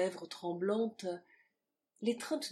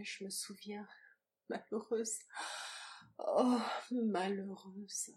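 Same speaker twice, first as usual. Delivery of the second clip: moaning; 4 dB quieter than usual. A narrator's reading. Woman remembering her misery, soft moaning lament. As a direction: lament under the breath. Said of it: "gémit-elle".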